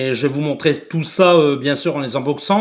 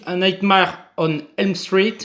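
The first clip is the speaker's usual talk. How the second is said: the English title in a mock English accent; self-mocking English accent on the title. Shown as a demonstration. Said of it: "j'ai un très bon accent anglais".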